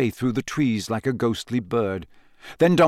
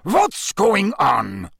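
higher, very slow alarmed demand; agitated cry; 7 semitones higher, much slower than usual. A narrator's reading. Karkaroff demanding to know what happened, agitated. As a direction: demand an answer, rattled. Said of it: "he cried".

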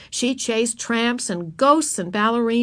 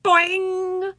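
put-on high-pitched, springy child's cry; playful high teasing call; yelled boing; 10 semitones higher than usual. A narrator's reading. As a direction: sing out a twanging boing. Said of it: "she tweaked one of Susan's curls and yelled".

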